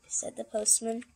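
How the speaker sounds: plain reading voice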